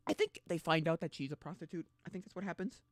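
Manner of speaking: ordinary talk, nothing marked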